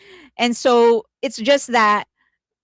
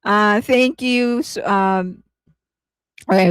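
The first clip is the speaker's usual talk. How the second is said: usual speaking voice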